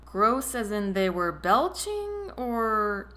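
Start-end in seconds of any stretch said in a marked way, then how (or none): none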